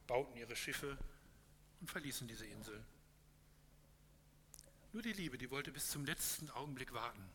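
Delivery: measured storytelling read to a congregation; story narration. A narrator's reading. Pastor reading a parable to the congregation, calm storytelling.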